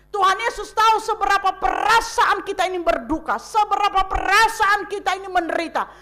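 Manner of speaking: ordinary delivery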